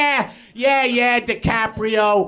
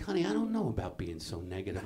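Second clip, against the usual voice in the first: soft worried aside; 16 dB quieter, much faster than usual. Retold or imitated. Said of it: "I'm like".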